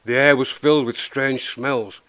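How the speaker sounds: ordinary delivery, nothing marked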